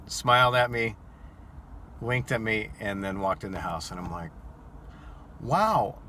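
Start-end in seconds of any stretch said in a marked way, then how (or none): 5.4-6.0 amazed. Retold or imitated. Driver stunned after the flashing.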